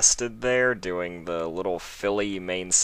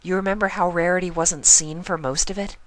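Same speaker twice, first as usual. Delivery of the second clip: bright high-pitched filly voice, recalling; high pony girl voice; 12 semitones higher, 4 dB louder than usual. Acted. Pony friend reminds Spike about the birthday, high voice. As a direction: voice her high above your own.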